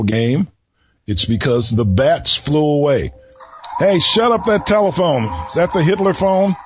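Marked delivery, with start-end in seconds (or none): 3.8-6.6 annoyed outburst. Real, not acted. Scolding a ringing phone.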